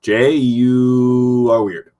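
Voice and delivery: very slow deadpan voice for Captain Common Sense; slow, blunt obvious-truth voice. Pretend. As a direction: state the obvious slowly, like a know-it-all hero. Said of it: "You should be like".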